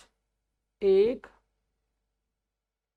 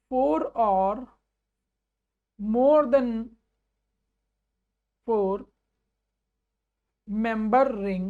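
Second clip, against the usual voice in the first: slow, word by word, with long pauses; slow dictation; much slower than usual. Shown as a demonstration. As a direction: dictate it for students to write down. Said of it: "आप ऐसे कहोगे".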